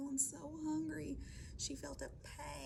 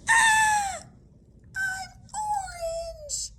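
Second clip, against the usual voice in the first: high, loud panicked shriek, drawn out; hammed-up horror at your own body; scared scream; 18 dB louder, much slower than usual. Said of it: "He was so scared".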